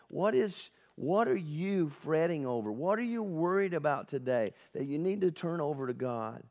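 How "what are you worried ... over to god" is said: ordinary talk, nothing marked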